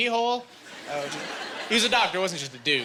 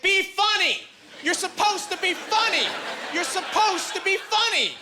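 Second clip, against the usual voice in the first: put-on drunk heckler yelling; 12 semitones higher, slower than usual. Drunk lady heckles the host, high slow screaming. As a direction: shriek it at the stage over and over. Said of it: "she starts screaming at me".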